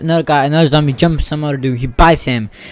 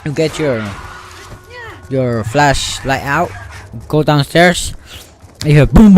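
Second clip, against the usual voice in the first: ordinary talk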